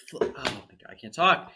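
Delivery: coughing and choking mid-sentence; choking on food. Real, not acted. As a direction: talk while choking on a cashew. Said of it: "I'm choking".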